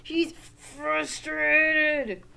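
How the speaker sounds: loud, high, slow mock frustration; acted frustration. Teacher on boy who can't tie shoes, hammed-up frustration. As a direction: strain through the word, hammed up for kids.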